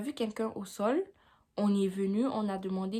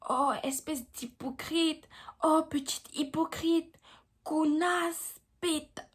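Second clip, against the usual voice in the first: higher, slow sneering voice of the woman; mock insulting woman; 7 semitones higher, much slower than usual. Mocking the woman who insulted her: high, slow sneer. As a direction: drag out the insults like a scornful stranger. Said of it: "elle commence à me dire".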